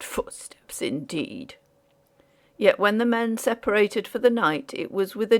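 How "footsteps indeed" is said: scoffing whisper, trailing off. A narrator's reading. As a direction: murmur it dismissively. Scoffing at ghost talk.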